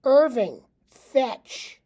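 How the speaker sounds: loud, sharp call to the dog; commanding shout. Acted. Owner calling his talking dog, loud command. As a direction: bark the order at the dog. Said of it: "and commands".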